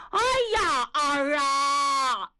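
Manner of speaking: high, drawn-out theatrical wail; loud wailing. A narrator's reading. Old man mourns his lost son, wailing aloud. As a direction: cry out in long, performed sobs of grief. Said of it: "放声大哭".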